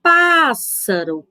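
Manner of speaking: "pássaro" with a lengthened "pás", then a lighter ending; stressed syllable held. Shown as a demonstration. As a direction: lean on the first syllable of "pássaro". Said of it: "Falando a palavra".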